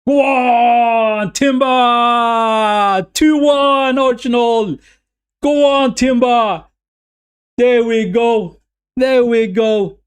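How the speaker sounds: high, loud goal celebration; excited shout. Real, not acted. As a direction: yell it out as the goal goes in. Goal celebration, Arsenal score.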